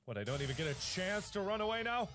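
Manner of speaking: ordinary talk, nothing marked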